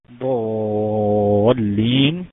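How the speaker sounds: ضالين with the long aa held six counts, loud; six-count madd demo. Shown as a demonstration. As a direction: stretch the aa in ضالين very long and strong. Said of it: "مد لازم كلمي مثقل".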